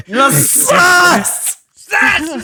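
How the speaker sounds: high shouted sus; wild unhinged ranting. Retold or imitated. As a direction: yell it like a madman. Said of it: "sounded like a fucking madman".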